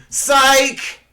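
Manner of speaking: loud, high-pitched mock gotcha; teasing gotcha. Shown as a demonstration. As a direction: shout it to spring a prank. Demonstrating a teasing nineties catchphrase.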